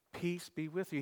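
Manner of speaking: softly echoed blessing; soft repeat. Acted. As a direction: let "Peace be with you." fall soft and tender. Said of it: "second time".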